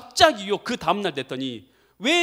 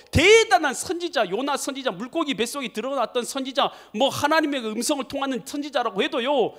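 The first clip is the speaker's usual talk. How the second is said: ordinary talk, nothing marked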